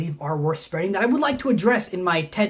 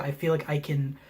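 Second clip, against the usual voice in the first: ordinary delivery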